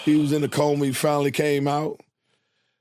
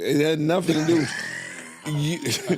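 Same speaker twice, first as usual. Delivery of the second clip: excited protest; 6 semitones higher than usual. Real, not acted. Heated defence of his fear.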